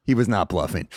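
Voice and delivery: normal talking voice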